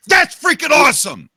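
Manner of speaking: high, loud burst of delight; real whoop of amazement; excited shout. Real, not acted. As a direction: shout it out, totally thrilled. Thrilled at seeing the game model.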